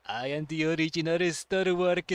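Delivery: low booming Star Walker proclamation. Acted. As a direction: intone it like a grand self-important star. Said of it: "that character sounds like".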